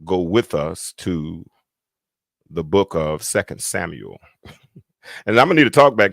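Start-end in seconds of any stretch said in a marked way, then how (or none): 0.0-4.2 measured, drawn-out church preacher voice. Shown as a demonstration. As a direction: invite the congregation along in a stately preacher voice. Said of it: "I'm using my, my preacher voice".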